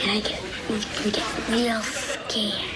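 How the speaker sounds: hushed, frightened whisper; scared whisper. Acted. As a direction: whisper how scared you were. Child describing fear.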